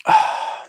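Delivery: long, easy exhale; relaxed sigh. Real, not acted. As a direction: breathe out as you settle down. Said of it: "Just relaxing now".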